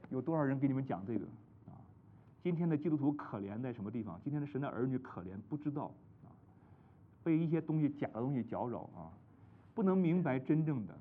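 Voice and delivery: ordinary delivery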